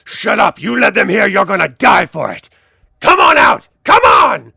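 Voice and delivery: loud, threatening shout, rising to a yell; angry calling out. Acted. Gang captor orders intruders to come out, angry shout. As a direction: bellow it down an echoing corridor. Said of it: "Sort of calling out".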